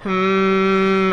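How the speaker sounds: a short soft demo hum. Shown as a demonstration. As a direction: hum gently to show how. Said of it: "Just go".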